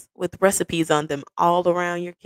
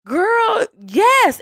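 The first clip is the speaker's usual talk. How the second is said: high excited yes. Retold or imitated. Partner accepting, voiced high.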